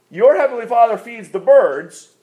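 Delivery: "Your" punched high at the start; stress on YOUR. Shown as a demonstration. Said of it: "emphasis on the next word".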